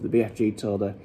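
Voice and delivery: plain reading voice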